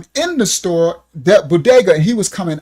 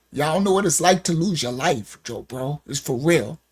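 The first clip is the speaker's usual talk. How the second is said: choked, soft and tearful; 3 semitones lower, 5 dB quieter than usual. Real, not acted. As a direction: hold back real tears. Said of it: "These tears are new".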